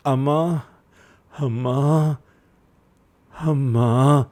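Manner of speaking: lightly voiced moaning calls, spaced out; a hint of a hurt man calling for his mother. A narrator's reading. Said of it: "మూలుగుతున్నప్పుడల్లా".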